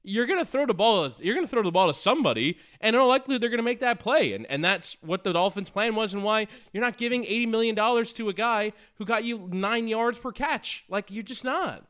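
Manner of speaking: ordinary talk, nothing marked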